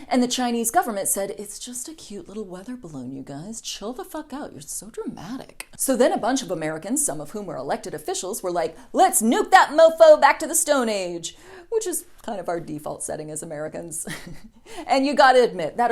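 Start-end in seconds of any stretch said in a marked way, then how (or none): 1.4-5.7 soft, breezy brush-off, much quieter; dismissive soft whisper. Acted. Chinese govt voiced dismissive. 8.9-11.4 gung-ho shout. Acted. Americans voiced gung-ho.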